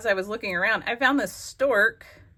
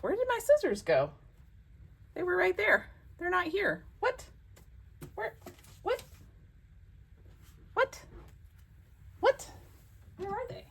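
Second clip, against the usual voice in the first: much higher, puzzled searching; baffled; 12 semitones higher, 4 dB quieter than usual. Real, not acted. Crafter puzzled, searching for her missing scissors. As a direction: hunt around, bewildered.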